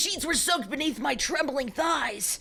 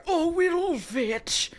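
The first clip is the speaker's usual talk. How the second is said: huskily; 4 semitones higher, much slower than usual. Acted. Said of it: "Lucas said huskily".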